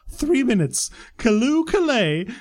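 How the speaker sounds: high, drawn-out, grandly hammed-up jubilation; mock royal proclamation. Worker sarcastically celebrating minutes back from a meeting, mock royal proclamation. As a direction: proclaim it slowly like an overjoyed herald.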